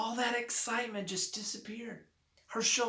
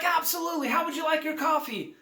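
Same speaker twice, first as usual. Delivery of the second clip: high, bright-eyed barista greeting; chipper and excited; 10 semitones higher, 5 dB louder, faster than usual. Retold or imitated. Eager hotel barista greeting a customer, high and excited. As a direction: imitate an over-the-moon barista, pitched way up and louder. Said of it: "She's all chipper and excited".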